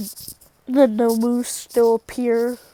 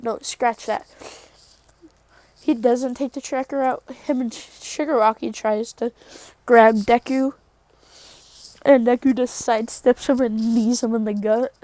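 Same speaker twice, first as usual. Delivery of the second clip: ordinary talk, nothing marked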